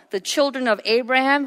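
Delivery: normal talking voice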